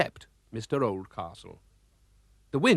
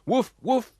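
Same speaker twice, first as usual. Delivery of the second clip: high barks; dog barking; 11 semitones higher, 3 dB louder than usual. Acted. Horace the dog barking in the crowded car, high woofs. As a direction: bark like an excited little dog. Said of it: "went Horace".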